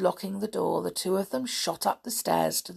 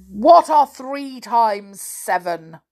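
loud, slow question; demanding giant; 2 semitones higher, 8 dB louder, slower than usual. A narrator's reading. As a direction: put it slowly and forcefully, expecting an answer. Giant demands answer from servant.

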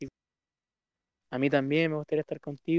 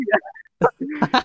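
risa aguda; 11 semitones higher, 7 dB louder than usual. Real, not acted. Se ríe tras demostrar su voz.

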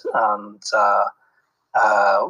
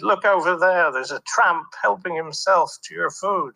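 imitating a posh local; 8 semitones higher than usual. Local man points at the tramp.

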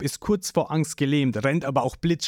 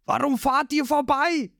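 Zorro shouting; 11 semitones higher than usual. A narrator's reading.

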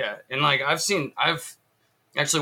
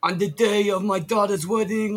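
mumbled mafia don, pitched up; Godfather voice; 7 semitones higher, 3 dB louder than usual. Retold or imitated. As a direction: do a quick mob boss line through cotton in the mouth. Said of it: "a little bit Godfather on this end".

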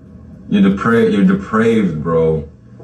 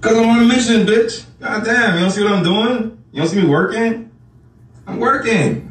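high-pitched exasperated defence; indignant; 10 semitones higher than usual. Real, not acted. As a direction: protest like it should be obvious.

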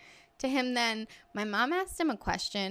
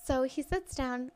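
ordinary talk

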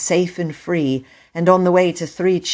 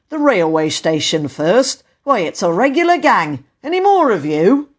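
lightly voiced official, rising louder; stern station master; 5 semitones higher, 5 dB louder than usual. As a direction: challenge a gang you have caught. Station master challenges the gang.

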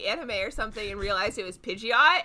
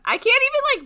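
normal talking voice

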